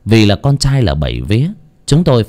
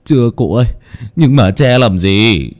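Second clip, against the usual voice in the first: nonchalant. A narrator's reading. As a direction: answer the elder as if it hardly matters. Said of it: "ông đáp một câu tỉnh bơ". (đáp tỉnh bơ, kéo dài câu cuối)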